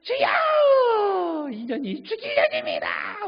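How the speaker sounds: high, loud crying wail to the Lord; wailing prayer. Retold or imitated. Church lady crying out in repentance, loud wailing. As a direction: wail it out like a weeping woman at a revival.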